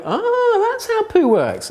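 a big high "ah" of discovery; mock eureka. Presenter acting a reader on the toilet, high and delighted. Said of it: "and you're going".

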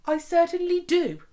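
ordinary delivery, nothing marked

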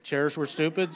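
ordinary delivery

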